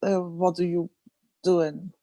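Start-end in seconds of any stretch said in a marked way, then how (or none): none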